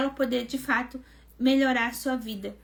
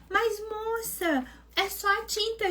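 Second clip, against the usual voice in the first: pleading excuse; 8 semitones higher, 3 dB quieter, slower than usual. Retold or imitated. Boy excusing himself.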